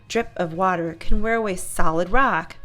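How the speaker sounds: ordinary delivery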